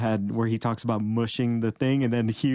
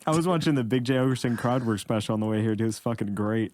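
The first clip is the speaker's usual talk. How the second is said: normal talking voice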